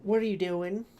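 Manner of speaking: lightly acted southern accent; a hint of southern. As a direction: challenge someone in a mild southern accent. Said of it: "Echo has a southern accent".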